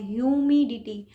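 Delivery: "Humidity" slowly, with weight on मि; stress on मि. Shown as a demonstration. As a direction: lean on the second syllable of "humidity", slowly. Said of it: "मी पे यहाँ पे stress दिया".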